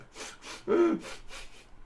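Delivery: a high, airless sobbing gasp; mock sobbing gasp. Commentator mocking the arrested woman's tears, hammed-up sob gasp. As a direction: gasp in like a crying little kid. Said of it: "Sobbing like a little babe".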